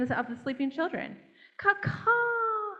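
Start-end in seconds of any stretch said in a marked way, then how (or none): 1.6-2.7 high squawking caw-caw; crow caw. A narrator's reading. Storyteller squawks like the crow to the children. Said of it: "said the crow".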